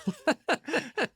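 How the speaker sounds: a sudden high laugh breaking out; high burst of laughter. Real, not acted. Guest laughing at his own cigar joke, high laugh.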